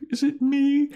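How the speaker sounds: very high falsetto, insecure; Willow voice. Retold or imitated. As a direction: squeak it like a worried girl.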